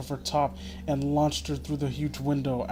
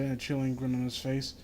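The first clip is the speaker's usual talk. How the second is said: ordinary delivery, nothing marked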